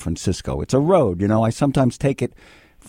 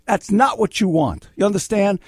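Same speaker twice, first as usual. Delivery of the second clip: ordinary talk, nothing marked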